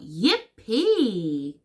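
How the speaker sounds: loud, swooping cheer; crying out. A narrator's reading. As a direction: whoop it out with glee. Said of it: "cried out".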